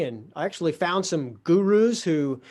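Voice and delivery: ordinary talk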